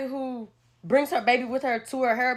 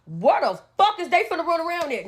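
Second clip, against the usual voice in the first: normal talking voice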